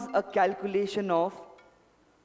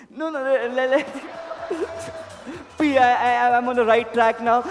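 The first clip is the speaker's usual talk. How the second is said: excited; 4 semitones higher, 6 dB louder than usual. Real, not acted. Mentalist guesses name, excited.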